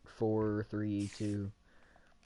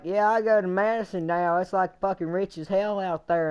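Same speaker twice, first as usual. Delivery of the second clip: bragging friend; 6 semitones higher than usual. Retold or imitated. Friend voiced in retold story.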